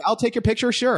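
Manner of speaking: high, laughing eager offer; excited offer. Retold or imitated. Fan offering to take the actor's photo, giddy and laughing. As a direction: squeak it out, thrilled to help.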